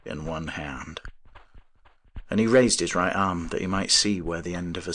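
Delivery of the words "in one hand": low, soft, sober echo; subdued echo. A narrator's reading. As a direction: repeat it quietly and gravely. Professor echoes boast with empty sleeve.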